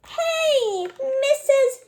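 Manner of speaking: high, loud calling voice; high loud excited greeting. Acted. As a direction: call out to the angel. Character calls an angel.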